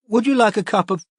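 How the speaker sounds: lightly voiced worried mother; concerned mother. A narrator's reading. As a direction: offer tea gently, mother. Mother offers tea gently.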